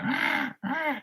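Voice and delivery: very high, soft imitated crow caw. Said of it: "And they went".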